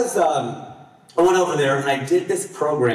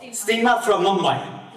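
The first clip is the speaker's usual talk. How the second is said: imitating Seema; 6 semitones higher, slower than usual. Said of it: "she calls herself".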